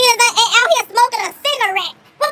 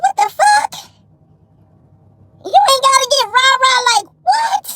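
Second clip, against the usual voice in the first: outraged retold yell; 4 semitones higher than usual. Retelling the cashier's rudeness, an outraged yell. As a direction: shout it back in disbelief, voice cracking. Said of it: "I said".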